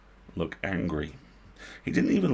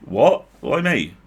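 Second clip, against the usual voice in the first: startled; 5 semitones higher, 8 dB louder, slower than usual. Acted. Goblin startled at being picked.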